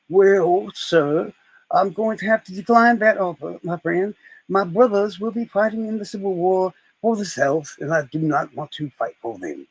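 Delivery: courtly Southern gentleman general; Robert E. Lee. Retold or imitated. General turning down Lincoln's offer, Southern gentleman voice. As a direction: decline politely in a Southern drawl. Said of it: "So Robert E. Lee comes in".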